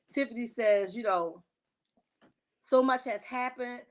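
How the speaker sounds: ordinary talk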